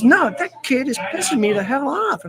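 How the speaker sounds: mildly acted angry complaint; retold anger. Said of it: "She's so angry".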